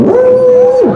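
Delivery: high loud mock wail; mother's wail. Mother finally crying away from the school, a high wail. Mother cries when alone.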